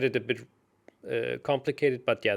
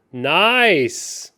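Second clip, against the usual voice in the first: excited; 5 semitones higher, 10 dB louder than usual. Real, not acted. After finding the checkmate.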